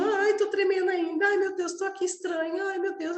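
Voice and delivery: a hint of panic, still trembling after the trance; mock fluster. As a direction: play a flustered newcomer, high and breathless. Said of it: "e você continua sentindo".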